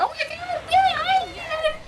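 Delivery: knowing fans. Retold or imitated. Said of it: "They're like".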